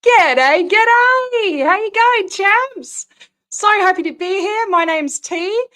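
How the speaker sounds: loud, high, beaming hello to the crowd; a loud high-pitched showbiz welcome; excited greeting. Real, not acted. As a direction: burst in with big Aussie cheer. Host's loud opening greeting to viewers.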